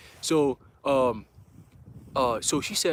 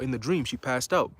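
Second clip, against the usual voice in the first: ordinary talk, nothing marked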